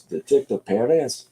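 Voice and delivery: lightly put-on Spanish pronunciation; Spanish accent. Shown as a demonstration. Streamer trying it for Spanish speakers, Spanish accent. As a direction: try the name with a Spanish accent. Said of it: "For Spanish people".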